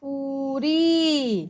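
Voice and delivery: पूरी very slow with a long ऊ; long vowel drawn out. Shown as a demonstration. Teacher showing the long vowel in पूरी. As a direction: drag out the ऊ in पूरी. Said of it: "पूरी बोलते हैं हम या".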